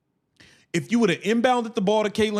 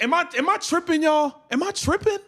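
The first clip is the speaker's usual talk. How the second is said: fast and much higher, pleading to chat; incredulous disbelief; 7 semitones higher than usual. Real, not acted. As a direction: rush it up high like you can't believe it.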